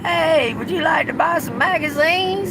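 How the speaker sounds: high-pitched Southern sales call voice; telemarketer pitch. Retold or imitated. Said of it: "I used to be a telemarketer".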